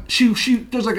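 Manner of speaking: ordinary delivery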